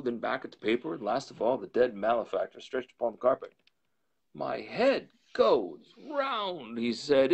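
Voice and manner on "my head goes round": dazed. A narrator's reading. Lawyer stunned by the will.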